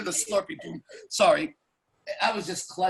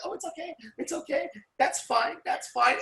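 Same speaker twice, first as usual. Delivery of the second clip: put-on girlfriend's reassurance; 8 semitones higher, 4 dB quieter than usual. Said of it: "She was like".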